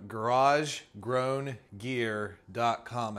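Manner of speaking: measured, deliberate pace on a tricky name; slow and careful. Real, not acted. Host sounding out a tricky shop name, slow and careful. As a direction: say each word slowly and separately. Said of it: "I have to say it slow".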